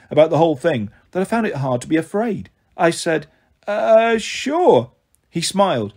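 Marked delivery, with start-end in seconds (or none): 3.7-4.9 drawn-out, higher, unsure yes; hesitant agreement. Acted. Boy warily lets a stranger join him, hesitant. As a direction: agree uncertainly, pitch lifted.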